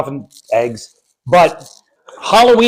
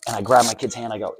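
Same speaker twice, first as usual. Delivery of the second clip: ordinary delivery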